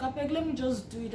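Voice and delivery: careless, offhand shrug of a line; casual shrug. Retold or imitated. Applicant talking to herself before the interview, offhand. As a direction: toss it off like it doesn't matter. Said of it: "I was just like".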